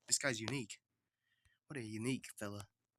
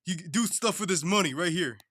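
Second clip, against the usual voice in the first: voiced cartoon character; 6 semitones higher, 9 dB louder than usual. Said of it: "and then he says".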